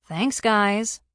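mock gratitude to meddling coworkers; eye-rolling thanks. A narrator's reading. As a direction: thank them with an eye-roll. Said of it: "Cassie rolled her eyes".

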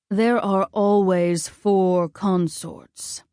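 slow, solemn god voice; stern god of war. A narrator's reading. Ares lays down the rule of passage, solemn god voice. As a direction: play a grave, commanding father, unhurried.

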